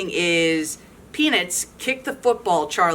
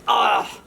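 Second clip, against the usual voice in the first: pained "ugh" as he hits the ground; hammed-up thud grunt; landing grunt; 3 semitones higher than usual. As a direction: grunt like you just fell flat. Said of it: "lands with an".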